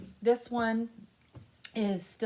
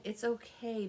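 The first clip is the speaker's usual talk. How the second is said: normal talking voice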